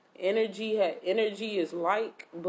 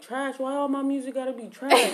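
high, indignant mock complaint; offended friend, pitched up; 6 semitones higher, 5 dB louder than usual. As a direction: play him wounded and loud. Retelling friend's offended reply.

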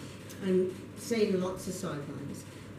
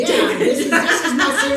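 giggling through the words; laughing; 7 semitones higher, 12 dB louder than usual. Real, not acted. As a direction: laugh while you try to start. Laughs while announcing serious voice.

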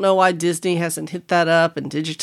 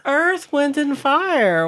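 impressed; 9 semitones higher, slower than usual. Real, not acted. Impressed repeat of band name.

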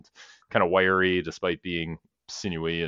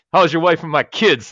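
put-on New York accent; 8 semitones higher, 6 dB louder than usual. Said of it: "with that New York accent".

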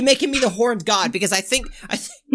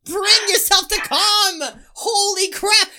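high, drawn-out exasperated command; mock exasperation; 7 semitones higher, slower than usual. Host ordering laughing co-host to calm down, exasperated. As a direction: call out to settle someone down.

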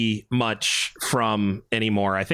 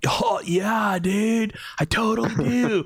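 high-pitched surfer-dude drawl; hammed-up stoked surfer bro; mock California surfer; 9 semitones higher, slower than usual. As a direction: play a totally Californian dude. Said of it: "And I'm like".

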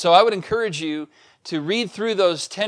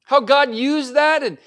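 much higher and louder fervent preaching; preaching at full force; 8 semitones higher, 6 dB louder than usual. Acted. Pastor stirring the congregation about God's promise, fervent and loud. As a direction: lift the church up to the promise.

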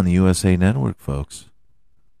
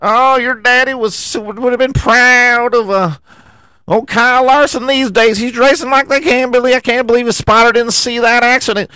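much higher, squeaky excited booth voice; mock commentator; 13 semitones higher than usual. Host mocking announcer Burton gushing to Junior, squeaky and high. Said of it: "He's like".

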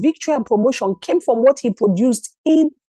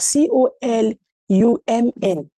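ordinary delivery

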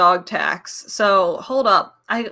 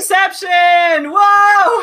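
loud high whoa; surprised shout; 8 semitones higher, 9 dB louder, much slower than usual. Real, not acted. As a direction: react in delighted shock. Seeing screen in screen.

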